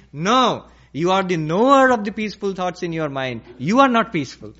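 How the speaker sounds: loud, emphatic reprimand; scolding Swami. Acted. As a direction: correct the disciple forcefully, as a stern teacher. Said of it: "And the Swami scolded him".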